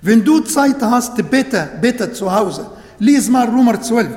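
ordinary talk, nothing marked

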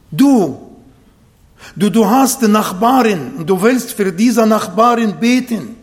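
usual speaking voice

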